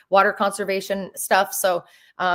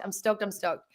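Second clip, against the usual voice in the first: quiet; 8 dB quieter than usual. Real, not acted.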